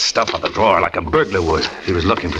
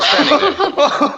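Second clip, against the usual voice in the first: breathless excitement; slower than usual. Acted. Couple bursting in laughing.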